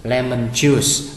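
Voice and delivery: Lemon juice said slowly, each syllable clear; slow model word. Shown as a demonstration. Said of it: "sẽ gọi cái đó là lemon juice". (đọc chậm rõ từng âm Lemon juice)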